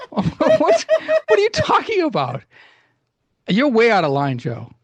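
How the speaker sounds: high indignant put-on co-host voice, laughing; hammed-up offended squeak; Biff voice. Biff, offended, scolds Joe in a high put-on voice. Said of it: "This was Joe the entire time".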